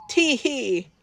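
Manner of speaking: a hammed-up tee-hee giggle; mock giggle. A narrator's reading. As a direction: giggle cheekily to blame fairies. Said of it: "Dad giggled".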